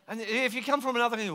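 normal talking voice

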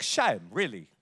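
light South African twang on the words; lightly imitated South African accent. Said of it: "she learned the accent".

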